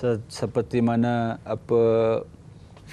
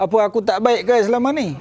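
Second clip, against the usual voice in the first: mock-offended, louder and higher, half laughing; offended husband; 8 semitones higher, 5 dB louder than usual. Husband hears the prayer and snaps back, mock-offended and laughing. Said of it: "marah dia".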